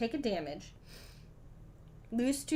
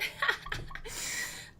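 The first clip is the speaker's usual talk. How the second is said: soft voiceless giggle; a quick self-conscious giggle; breathy giggle; 4 dB quieter than usual. Real, not acted. Streamer giggling at her own joke about focus. Laugh after self-deprecating remark.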